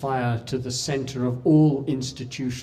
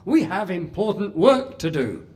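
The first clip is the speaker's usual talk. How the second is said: higher, louder hurried announcing; loud, high businesslike cutoff; relieved brisk chairman; 7 semitones higher, 8 dB louder than usual. Retold or imitated. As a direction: wrap it up fast for the room. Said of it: "like he was relieving them".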